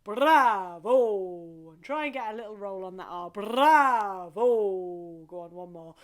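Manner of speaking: loud, full bravo from the gut; big hearty bravo; bravo from the belly. Shown as a demonstration. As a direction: push it up from the belly. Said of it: "right in the belly".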